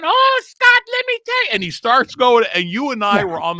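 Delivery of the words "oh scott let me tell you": very high squeaky falsetto; squeaky comic falsetto; high put-on voice. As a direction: pitch it way up like a cartoon. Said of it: "all of a sudden I go".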